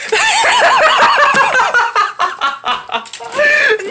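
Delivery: long high-pitched helpless laughter; real laughing fit. Real, not acted. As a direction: lose it completely at your own story. Cracks up at own story.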